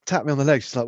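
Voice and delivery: ordinary talk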